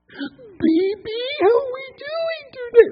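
put-on very high, sing-song baby talk. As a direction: coo at an infant. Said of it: "how they talk to an infant".

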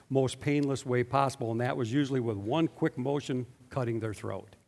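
ordinary talk, nothing marked

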